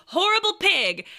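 ordinary talk, nothing marked

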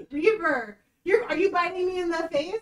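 high, laughing complaint at the dog; laughing protest. Real, not acted. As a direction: squeal through laughter as he bites. Dog biting her face, laughing.

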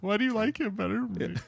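put-on high, soft whiny girlfriend voice; jealous girlfriend. A lineman mocking a jealous girlfriend, high and whiny. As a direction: whine it like a clingy partner. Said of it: "It's like a jealous girlfriend".

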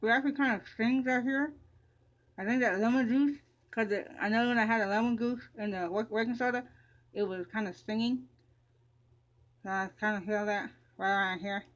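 toothbrush in mouth; brushing teeth while talking. Real, not acted. Seen 3: Toothbrush in mouth and toothpaste foam throughout the labelled words.

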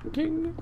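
single high, soft chime noise; ping out a tiny high ding; imitated ding. Imitates a ding while building.

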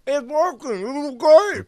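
high, loud cry through a mouthful; mouth full. Acted. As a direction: shout it with your mouth full. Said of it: "I say with my mouth still full".

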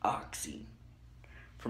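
whispered aside. Real, not acted. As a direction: breathe the word out. Whispered aside while packing.

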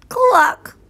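high clucking squawk; hen cluck. A narrator's reading. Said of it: "she said".

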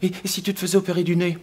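high, quick mimicry of a partner; nagging partner. Partner suggesting surgery for his snoring, high nagging mimicry. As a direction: pitch it up as a sudden bright idea. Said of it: "il faudrait que t'ailles voir quelqu'un".

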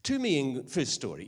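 ordinary delivery

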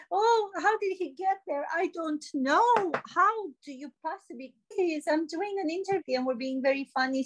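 high, theatrical fluster; flustered mock delight. Interviewee surprised by partner during interview, flustered mock delight. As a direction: gush high in staged astonishment.

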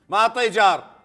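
loud, unaware of own volume; too loud, headphones on. Real, not acted. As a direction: shout over music in your ears. Guesser wearing loud headphones.

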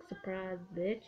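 understated little taunt; sly voiced quote. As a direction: say it quietly, as the lurking unit. Voicing the meteor that ambushes.

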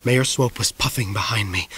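ordinary delivery

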